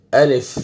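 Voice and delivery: الف with a light, thin first vowel and clear f; correct alif. Shown as a demonstration. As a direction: say الف lightly, lip touched to front teeth. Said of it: "وہاں پہ آپ نے touch کرنا ہے". (باریک الف)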